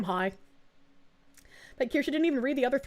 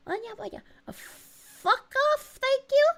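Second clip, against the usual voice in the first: much higher, startled squeak; high squeaky outburst; 13 semitones higher, much slower than usual. Real, not acted. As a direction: yelp it out, shocked. Shocked reaction to chat.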